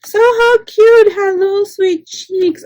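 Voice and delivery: high, slow cooing over something adorable; gushing over cute gift. Real, not acted.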